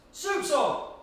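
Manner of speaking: high, bright announcement; cheerful call. Acted. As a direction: sing it out.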